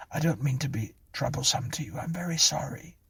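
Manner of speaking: high, hurried apology; meek apology. A narrator's reading. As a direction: apologise quickly and timidly. Clara apologises to policeman.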